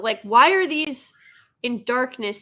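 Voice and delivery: ordinary talk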